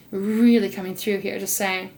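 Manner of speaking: normal talking voice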